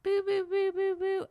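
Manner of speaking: high-pitched repeated boo, boo; imitated car noise. Caller imitating a car noise for the mechanics, high repeated boos. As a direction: mimic a car noise with your mouth. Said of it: "It's making this noise".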